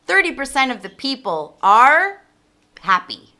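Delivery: Thirty percent of the people are with a lift on are; stress on are. Shown as a demonstration. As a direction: lean on "are" as the right verb. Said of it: "we're gonna use are".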